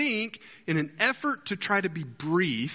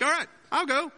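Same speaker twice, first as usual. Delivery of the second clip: high, eager mock confidence; confident volunteer; 11 semitones higher than usual. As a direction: act the cocky next guy, pitched up. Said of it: "They're all confident".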